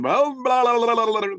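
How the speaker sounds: high, loud babbled gibberish; mock speaking in tongues. Imitating Pentecostals talking in tongues, mock babble. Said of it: "who talk in, you know".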